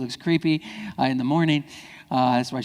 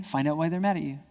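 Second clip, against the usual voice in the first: usual speaking voice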